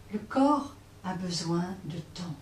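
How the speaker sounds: usual speaking voice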